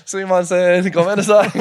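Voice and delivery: loud, high comic pleading; laughing, high-pitched mock begging; mock Japanese apology. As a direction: cry out an apology through laughter.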